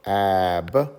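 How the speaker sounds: Ab with a long held short a; drawn-out aaa, then b in Ab; sounding out. Shown as a demonstration. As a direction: stretch Ab slowly, a as in apple. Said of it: "Now that we know the sounds".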